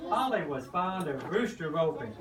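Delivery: ordinary delivery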